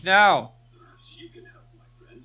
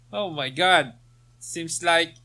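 ordinary delivery

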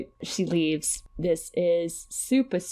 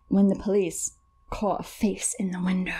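ordinary delivery

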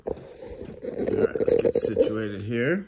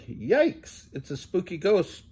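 mild mock scream; 9 semitones higher, 3 dB louder than usual. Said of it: "screams Pete".

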